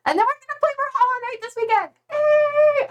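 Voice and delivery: high-pitched excited cheer. Real, not acted. Streamer tells chat about weekend Hollow Knight, excited yay. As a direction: squeal it out with glee. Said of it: "I'm so excited".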